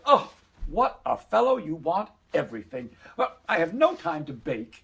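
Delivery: high, loud "Oh", then a scolding complaint; exasperated mother. Acted. Mother refusing to bake for her son, exasperated. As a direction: complain as if put upon.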